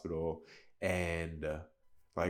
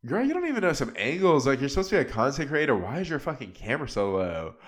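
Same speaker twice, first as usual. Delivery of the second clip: higher, louder mock scolding at the screen; sassy call-out; 7 semitones higher, 4 dB louder, faster than usual. Talking to a cast member through the screen, sassy louder scolding. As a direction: roast her bad camera angles, fast and sassy. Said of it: "and I'm like".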